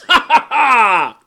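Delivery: loud, high, drawn-out guffaw; hearty stage laugh. A narrator's reading. Prime minister laughing with his cronies, uproarious. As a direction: laugh uproariously at your own joke. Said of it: "his laugh was chorused by the seven".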